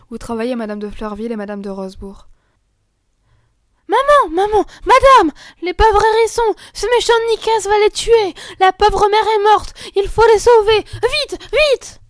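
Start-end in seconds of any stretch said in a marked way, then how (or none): 3.9-12.0 put-on very high, loud children all talking at once; breathless alarm. Girls rushing to mother to save the hedgehogs, breathless. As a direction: burst in panicked and out of breath.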